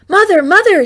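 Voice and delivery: high, loud call for mother. A narrator's reading. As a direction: call across the yard, excited. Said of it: "she called".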